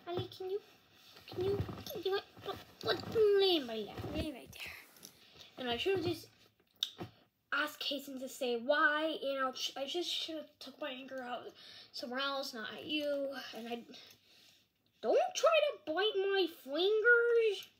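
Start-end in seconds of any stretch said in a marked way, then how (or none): 0.1-4.7 coaxing the dog. Real, not acted. Coaxing the dog onto lap. 15.0-17.7 high, loud and slow, telling the dog off; playful scold. Real, not acted. Kid scolds his dog for nipping fingers, playful scold.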